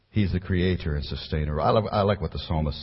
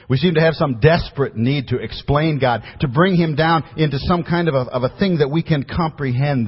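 higher and louder, pressing the point; fervent preaching; 6 semitones higher, 6 dB louder than usual. Acted. Pastor warning congregation about explaining God, fervent preaching. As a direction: preach it hard to the pews. Preacher builds to full force.

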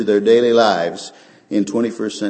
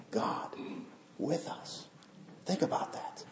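hushed reverence; 6 semitones higher, 18 dB quieter, slower than usual. Acted. Hushed emphasis.

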